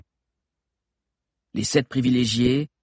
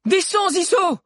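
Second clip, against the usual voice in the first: very high, loud urgent yell; high-pitched alarmed shout; 6 dB louder, slower than usual. A narrator's reading. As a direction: cry out a warning to someone up high. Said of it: "cria Alix".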